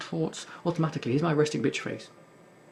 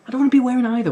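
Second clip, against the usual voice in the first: much higher, much louder objection; loud protest; 8 semitones higher, 10 dB louder, much faster than usual. Real, not acted. Player refusing high heels or a dress, loud protest. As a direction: burst out in loud indignant refusal.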